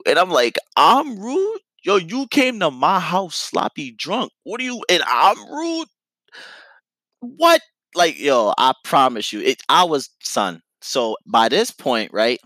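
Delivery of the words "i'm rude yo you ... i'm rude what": how dare you call me rude; outraged disbelief. Retold or imitated. Retold argument, outraged at accusation.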